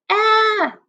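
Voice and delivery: high, loud frightened "Ah!"; scared yelp. Acted. Teacher voicing the scared player again, loud high yelp. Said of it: "And he says".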